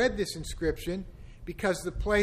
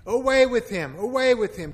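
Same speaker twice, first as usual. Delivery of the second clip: loud, high shouting; crying out; 5 semitones higher, 8 dB louder than usual. A narrator's reading. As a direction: shout them down twice. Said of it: "They cried out".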